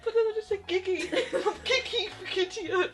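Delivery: laughing through the words; crying with laughter; laughing hard. Real, not acted. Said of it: "I'm crying".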